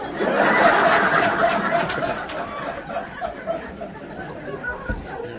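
long high breathy laugh; long real laugh. Real, not acted. Hearing that the drunk told everyone, a long real laugh. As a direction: give in to a big laugh. Friend tells the news.

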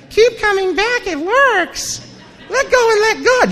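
high, sing-song mock cheerfulness; mocking AA cheer. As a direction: parody the chirpy AA greeters. Mocking happy AA people.

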